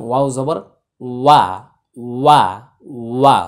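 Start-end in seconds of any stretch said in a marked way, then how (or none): 1.2-3.4 ওয়া said short and crisp, repeated; careful demo. Shown as a demonstration. Teacher asks students to listen, then pronounces ওয়া carefully. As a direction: clip ওয়া cleanly three times. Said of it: "একটু মন দিয়ে শুনুন".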